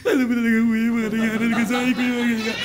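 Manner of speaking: imitated high-pitched tearful rambling; resume the pretend crying mid-complaint; hammed-up weepy pleading; mock sobbing. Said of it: "iyak nang iyak eh".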